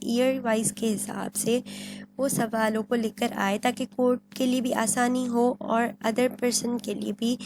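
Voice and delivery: ordinary delivery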